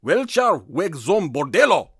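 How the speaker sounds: slow, loud, hammed-up German accent; best German accent. Shown as a demonstration. Host attempting a German phrase for his co-host, hammed-up German accent. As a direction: belt it out slowly in mock German. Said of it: "so this is in my best German".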